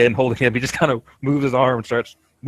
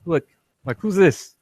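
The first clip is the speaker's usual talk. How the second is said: surprised high voice; 7 semitones higher than usual. Acted. Kumba finding the package.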